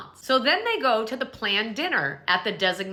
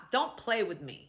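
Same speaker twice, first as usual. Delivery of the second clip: ordinary talk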